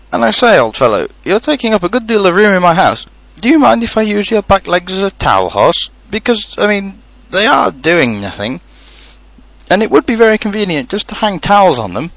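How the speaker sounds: high, chatty, self-concerned rabbit; practical rabbit. Acted. Rabbit asking to use Pooh's legs, practical. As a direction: make the odd request sound quite reasonable.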